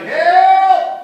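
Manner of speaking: loud, high yell for help; hammed-up storybook cry. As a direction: shout as if the whole family needs rescuing. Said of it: "finally they all cried".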